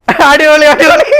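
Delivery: high, delighted cheer; excited praise. Real, not acted. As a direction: cheer it out, thrilled.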